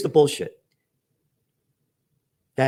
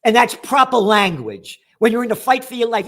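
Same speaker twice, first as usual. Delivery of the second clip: angry; 5 semitones higher, 6 dB louder than usual. Real, not acted. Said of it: "because I'm angry".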